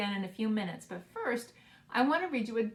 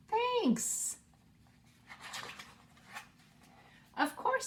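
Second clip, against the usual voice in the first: cheerful animal voices; 13 semitones higher, much slower than usual. Acted. Animals thanking each other.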